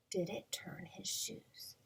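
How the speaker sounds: unvoiced hushed question; whisper. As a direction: whisper it to build suspense.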